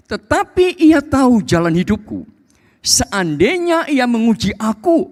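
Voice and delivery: loud, high declamatory Bible reading; ringing, emphatic scripture reading; preaching scripture. Acted. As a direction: proclaim the verse to the congregation. Reads scripture at full force.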